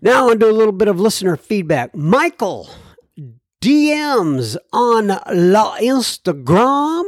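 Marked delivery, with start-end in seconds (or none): 1.9-7.0 put-on slow, higher playful French accent on La Instagram; mock French accent. Host reading listener mail, in a mock French accent. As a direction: ham up a French accent for the host's Instagram shoutout. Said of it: "this French accent thing".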